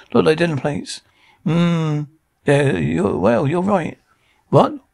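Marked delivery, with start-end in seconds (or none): 4.5-4.8 a sharp, high startled "What?"; jolted disbelief, higher and louder; surprised what. Acted. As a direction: caught off guard, blurt it out. Shocked by being called bad.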